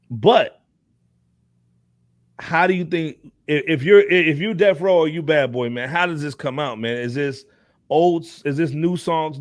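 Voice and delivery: ordinary talk, nothing marked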